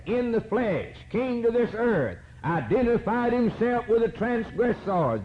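high, ringing preacher's cadence; fervent preaching. Acted. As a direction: preach it out with fire. Preaching at full force.

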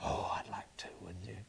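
soft breathy whisper of longing. Real, not acted. Preacher whispering his longing to see the glory. Longing aside after climax.

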